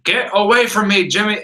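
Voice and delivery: a first warning to a pest, imitated; irritated rebuff. Gene rebuffing meddling Jimmy.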